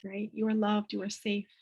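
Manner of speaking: put-on soft, tender calming words to a child; soothing reassurance. Shown as a demonstration. Mother soothing her daughter mid-tantrum, soft and tender. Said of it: "with Ella, I say".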